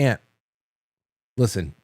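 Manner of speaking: mock-grave, low-key opener; pause, then ask for attention in earnest; straight-faced prank setup; deadpan serious. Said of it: "just get serious".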